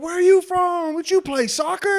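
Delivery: high, eager questions; excited questions. Retold or imitated. Birthday group excitedly quizzing the Irish-accented stranger. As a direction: ask it all thrilled and high. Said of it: "they were like".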